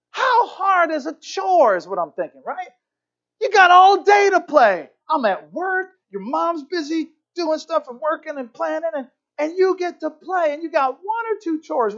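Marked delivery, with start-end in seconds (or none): 0.1-1.8 high, loud mock-parent outburst; exasperated rant. Father thinking about kids' chores, exasperated. Father ranting inwardly at kids. 3.4-12.0 high-pitched acted parental tirade; hammed-up lecture, starting on a shout; exasperated rant. Father lecturing kids who play all day, exasperated.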